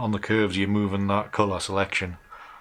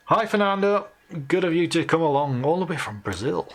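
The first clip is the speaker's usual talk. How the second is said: cheerful greeting; 8 semitones higher, 5 dB louder than usual. Real, not acted. Greeting a viewer on livestream.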